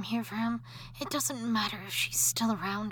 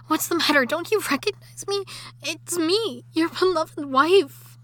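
higher, louder insistent plea; agitated pleading; 4 semitones higher, 6 dB louder than usual. Acted. Wife pleading with her terrified husband. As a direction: push him to recognise her.

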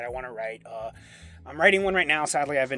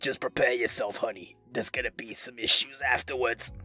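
two-dollar hooker voice, pitched down; Doctor Girlfriend; 3 semitones lower than usual. Shown as a demonstration. Voice actor demonstrating their funniest voice, low two-dollar hooker impression. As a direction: put on a low, comic streetwalker voice. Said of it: "my two dollar hooker voice".

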